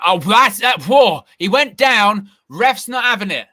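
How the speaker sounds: sudden live excitement; excited. Real, not acted. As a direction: jump on the moment as the player goes down. Live commentary, sudden foul claim.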